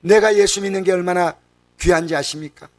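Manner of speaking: normal talking voice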